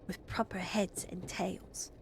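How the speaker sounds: hushed, awed whisper; a girl whispering to her siblings. Acted. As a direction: whisper it like a secret about dragons. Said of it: "whispered Phyllis".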